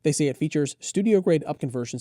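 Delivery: normal talking voice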